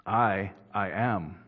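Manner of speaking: "I, I am" said slowly, each word weighted, a pause after the first "I"; slow emphatic. Shown as a demonstration. Preacher demonstrating ego eimi to the congregation, slow and emphatic. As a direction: dwell on "I" and "am" to show the Greek emphasis. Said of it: "which is an emphatic usage".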